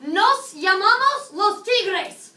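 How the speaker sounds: loud, high, drawn-out mock cheer; excited team shout. Students announcing their team name to teacher, excited shout. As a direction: call out to teacher like an eager team. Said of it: "And you can tell me".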